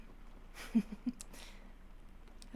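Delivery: breathy laugh. Acted. Vampire soft laugh.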